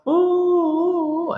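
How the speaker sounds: small, high mock whimpers; a feeble hired-mourner sob; weak sobbing. Shown as a demonstration. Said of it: "Kalau nangisnya cuma".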